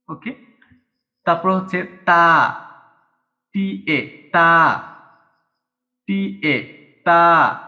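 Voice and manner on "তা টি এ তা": সা called out loud, then spelled H A; loud kana demo. Shown as a demonstration. Teacher drilling সা for learners, loud and clear. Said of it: "spells out ta for students".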